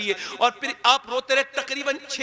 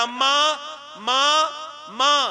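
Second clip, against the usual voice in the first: calling mother; 3 semitones higher than usual. Acted. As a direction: cry out for his mother. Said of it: "कहेगा".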